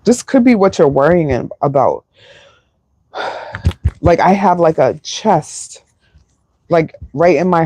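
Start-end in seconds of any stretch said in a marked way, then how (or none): 3.1-4.0 a soft breathy sigh of weariness; heavy sigh. Real, not acted. Tarot reader pausing mid-reading, soft tired sigh. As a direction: let out a long quiet sigh.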